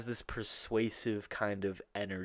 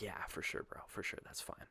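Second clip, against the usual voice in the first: casual clerk reply; 2 semitones lower, 13 dB quieter than usual. Retold or imitated. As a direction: imitate a laid-back clerk, very soft. Imitates easygoing counter guy.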